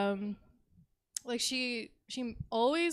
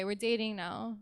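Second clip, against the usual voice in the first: ordinary delivery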